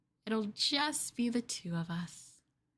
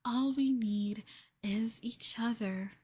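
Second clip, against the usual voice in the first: ordinary talk, nothing marked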